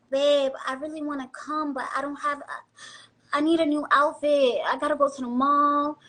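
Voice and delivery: sugary, pleading sweet talk to a man; pouty girlfriend angling for a shopping trip; coaxing girlfriend voice. Shown as a demonstration. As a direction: coax him into paying, all honey and pout. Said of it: "you gotta talk like this".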